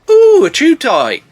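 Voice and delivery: high, loud, drawn-out excitement; slow, high mock delight; delighted crocodile. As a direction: coo loudly like an excited pet. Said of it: "and the croc thinks".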